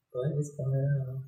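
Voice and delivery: quiet, muttered under the breath; half to yourself, stunned; bewildered murmur. Retold or imitated. Retold bewildered thought in prison.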